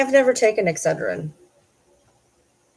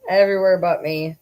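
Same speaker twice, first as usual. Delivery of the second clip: ordinary talk, nothing marked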